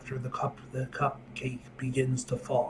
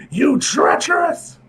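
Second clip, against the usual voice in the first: furious shout; 13 semitones higher, 11 dB louder, slower than usual. Acted. Villain outburst in read comic.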